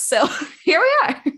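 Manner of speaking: real laugh breaking into the words; giggling through it, pitch jumping up. Real, not acted. Guest laughing at her own big dreams, laughing.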